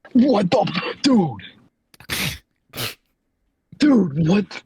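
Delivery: ordinary talk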